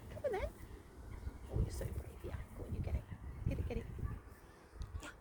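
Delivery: high soft squeaky coaxing. Real, not acted. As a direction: coo high at the squirrel. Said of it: "this is the squeaky voice that I put on".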